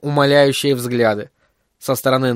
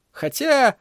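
high, tentative rising "although"; hesitant afterthought on a raised note; cautious addition; 12 semitones higher than usual. A narrator's reading. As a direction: add it cautiously, lifting the pitch. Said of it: "осторожно добавил он".

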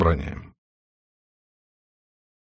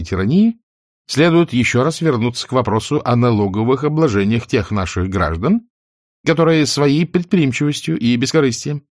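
higher, louder rally oration; oratorical speech; 6 semitones higher, 3 dB louder than usual. Acted. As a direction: declaim to the assembly.

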